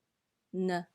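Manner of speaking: "呢" short, light and low, neutral tone. Shown as a demonstration. Teacher models Mandarin neutral tone on 呢, light. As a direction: drop "呢" off lightly, unstressed. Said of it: "same tone as tone zero".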